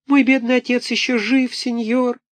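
put-on very high, wailing small-boy voice; pitiful little puppet. A narrator's reading. Buratino pleads with Karabas, high wailing. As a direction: wail like a poor orphan puppet.

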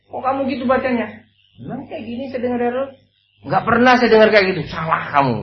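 high, quick retold quarrel between companions; mock argument. Companion snapping at another over Quran recitation, mock heated argument. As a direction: act out two men bickering over a reading. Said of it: "ribut ini".

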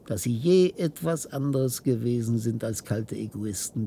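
ordinary delivery

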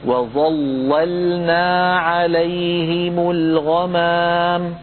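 slow chanted وظللنا with only the first لام heavy; وظللنا drawn out, first لام full, second light; Quranic recitation. Shown as a demonstration. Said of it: "عفوا أعيدها".

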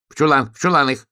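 bossy little storybook commander; commanding. A narrator's reading. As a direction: give orders briskly, voice up. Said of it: "командовал ёжик".

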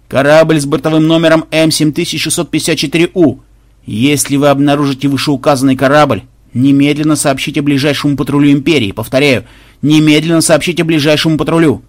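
droning monotone broadcast voice; official order. Acted. Flagship instructing a ship to report pirates, monotone official drone. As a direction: recite the order evenly and forcefully. Флагман отдаёт приказ кораблю.

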